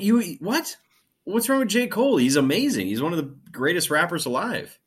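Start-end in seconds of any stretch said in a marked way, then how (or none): 0.0-2.2 incredulous. Real, not acted. Outraged defense of J. Cole.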